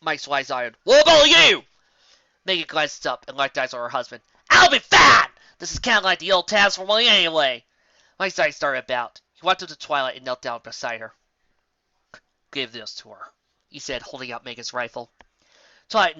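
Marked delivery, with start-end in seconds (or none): 0.8-1.7 high, loud question; shouting. Acted. Shouting in battle. 4.5-7.6 high, loud and confident; shouting. Acted. Shouting back. 12.5-13.3 quiet. Acted. Quiet handing over.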